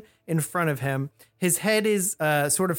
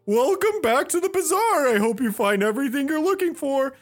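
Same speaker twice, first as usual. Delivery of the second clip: showman cat host; 10 semitones higher, 3 dB louder than usual. Acted. Cat host welcomes newcomers to the bazaar, showy and high. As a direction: greet them like a high-voiced ringmaster.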